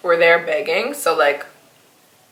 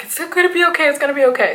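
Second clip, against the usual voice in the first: reassuring friend; 8 semitones higher, faster than usual. Retold or imitated. Robin reassures her.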